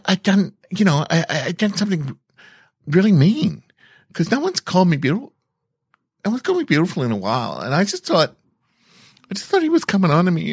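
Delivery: high, fast, upset confession; rushed, high-pitched confession to a friend of feeling she was mean. Real, not acted. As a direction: voice climbing as guilt spills out.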